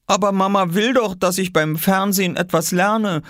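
put-on high child's protest; high-pitched defensive kid; boy defending himself. A narrator's reading. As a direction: argue back in a high boy's voice. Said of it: "verteidigte sich Oskar".

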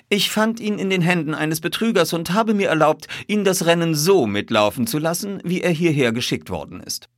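high-pitched, assured detective voice; composed detective, explaining. A narrator's reading. Detective telling colonel how he found it, assured. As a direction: lay out the facts smoothly.

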